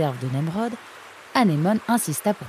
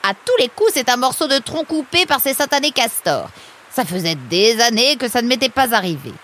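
high, louder exasperated complaint; indignant grumbling; 8 semitones higher, 6 dB louder than usual. Acted. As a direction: rail against the culprits. Blames beavers for blocked wheel.

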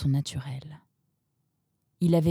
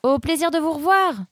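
put-on much higher, louder polite girl; Roxane, polie, aiguë; 11 semitones higher, 4 dB louder than usual. A narrator's reading. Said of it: "renchérit Roxane".

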